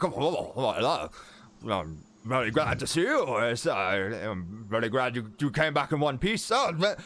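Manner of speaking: flustered, stammering uncle voice; halting greeting, swooping up high; Walden Crane. Acted. Uncle thanking the adventurers for finding her, flustered and delighted. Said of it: "He's like".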